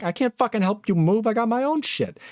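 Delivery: high, irritated brush-off; mock exasperated refusal. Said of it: "You're like".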